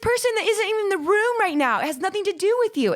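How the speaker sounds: high, fast, exasperated mock protest; mock yell. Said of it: "I'm like".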